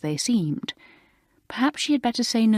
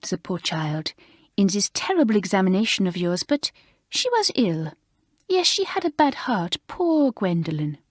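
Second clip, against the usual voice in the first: a fussing French mistress defending her pet; 2 semitones higher than usual. Acted. Narrator voices Mamselle.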